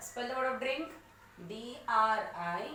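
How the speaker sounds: normal talking voice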